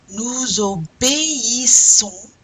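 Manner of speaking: "obéissons" slowly, loudly, é and i apart; slow teacher's model of "obéissons", o-bé-i-ssons; E and I separated. Shown as a demonstration. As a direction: sound é and i as two syllables in "obéissons". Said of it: "pronounce the E and the I separately".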